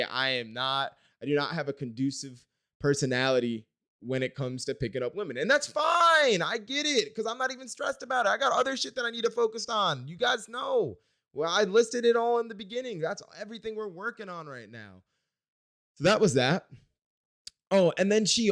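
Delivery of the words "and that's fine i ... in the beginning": high-pitched, insistent self-defence; defensive protest. Real, not acted. Coy boy excusing himself to viewers, high defensive protest. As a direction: pitch it way up, protesting you're unbothered. Defends having no luck with women.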